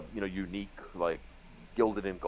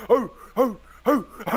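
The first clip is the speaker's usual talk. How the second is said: loud mock Santa ho ho; jolly laugh; 12 semitones higher, 7 dB louder than usual. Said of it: "Preston going around going".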